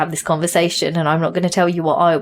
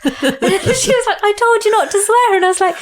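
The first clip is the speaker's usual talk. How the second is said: high, laughing retelling of a scolding; laughing while recalling the friend's mock telling-off; 3 dB louder than usual. Real, not acted. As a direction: giggle through the words, pitched up.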